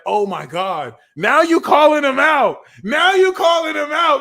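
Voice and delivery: high, loud indignant squeal; shocked people. Retold or imitated. Offended people reacting to Cancer, high loud squeal. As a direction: squeal it like someone just got called out. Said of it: "people are like".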